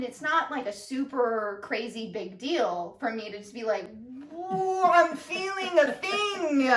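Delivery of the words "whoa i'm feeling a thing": drawn-out, higher mock-dramatic whoa; goofy slow-mo alarm. Shown as a demonstration. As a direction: ham it up, stretching every word. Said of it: "literally in that, like, goofy way".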